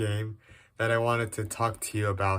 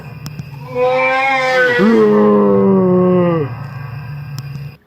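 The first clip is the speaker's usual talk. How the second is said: loud laugh; 9 semitones higher, 6 dB louder than usual. Real, not acted. Laughing again at game.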